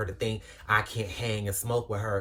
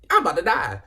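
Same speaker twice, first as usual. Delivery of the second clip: panicked; 6 semitones higher, 9 dB louder than usual. Retold or imitated. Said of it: "you just thinking like".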